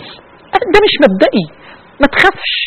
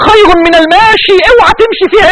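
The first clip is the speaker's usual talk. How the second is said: voice breaking; 6 semitones higher than usual. Real, not acted. Warning against strife, voice breaks.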